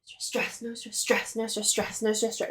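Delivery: "no stress, stress" softly chanted, each lone "stress" accented; rhythmic beat. Shown as a demonstration. Teacher showing how pentameter sounds, "no stress, stress" as a rhythmic beat. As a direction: tap out "no stress, stress" like a steady beat. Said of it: "and then it sounds like".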